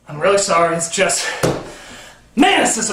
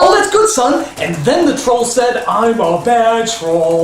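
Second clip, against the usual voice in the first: indulgent dad; 3 semitones higher, slower than usual. Pretend. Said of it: "five-year-old write the screenplay".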